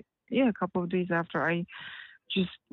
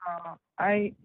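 breaking up like a weak cell signal; bad phone line; 2 semitones lower than usual. Real, not acted. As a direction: voice cutting in and out on a call. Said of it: "your voice is going in and out".

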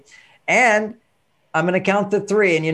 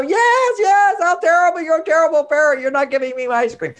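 much higher, louder mock wail; wailing child; 11 semitones higher, 3 dB louder than usual. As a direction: wail at the terrible parent like a kid. Child voiced wailing accusations.

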